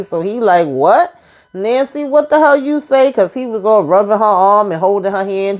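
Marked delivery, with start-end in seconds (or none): 0.7-3.1 rising higher and higher in shock; shocked disbelief. Retold or imitated. Richard reacting to Nancy's confession with rising shocked disbelief. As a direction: hit him with the news he can't believe.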